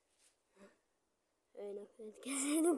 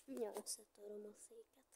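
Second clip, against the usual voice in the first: whispering; 5 semitones lower, 9 dB quieter, faster than usual. Real, not acted. Frustrated, about to break chair.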